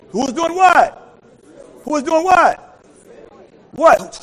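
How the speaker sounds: high, loud call for response; loud, high, slow preacher's cry; preaching shout. Real, not acted. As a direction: throw the question at the crowd and demand an answer.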